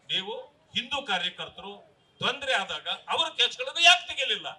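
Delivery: ordinary talk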